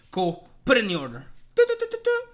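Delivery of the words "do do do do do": high sung do-do-do jingle mimicking a phone chime; sung notification chime. Mimicking the delivery app's chime, a high sung jingle. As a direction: hum the app's alert tones up high.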